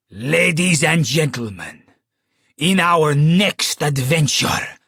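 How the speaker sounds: put-on loud, slow showman's Italian accent; booming Italian accent. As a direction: boom it out like an Italian stage magician. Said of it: "boomed with his Italian accent".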